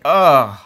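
loud disgusted groan; disgusted ugh. Real, not acted. Disgusted at photo.

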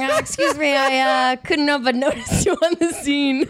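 mock smooth bar pickup voice; hammed-up suave flirting; smooth pickup line. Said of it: "I'm imagining you approaching me at a bar saying".

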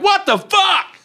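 very high-pitched mock-horrified squawk; hammed-up shocked outcry; acted yacht's alarm. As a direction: play a boat in disbelief, voice shooting way up. Voices the yacht's horrified reaction.

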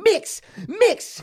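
high, loud mock Russian boss lady; the Russian ladies hurry the workers, in a high, loud mock Russian accent. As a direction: bark it like a pushy Russian supervisor. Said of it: "the Russian ladies would walk around going".

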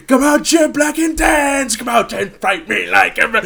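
high, loud Irish challenge to a fight; mock-furious Irish fighting call; Irish brawler. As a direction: shout a hammed-up Irish dare. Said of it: "leaned hard into being Irish".

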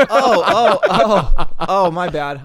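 loud laughing fake epiphany; laughing mock realization. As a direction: ham up the realization.

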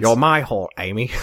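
low British character voice; British accent with dropped r's; Michael Caine. Shown as a demonstration. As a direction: do a low British film-star impression. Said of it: "sound like with a British accent".

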